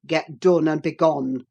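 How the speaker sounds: low, loud dismissal; harsh dismissal. A narrator's reading. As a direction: order her out. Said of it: "he exclaimed".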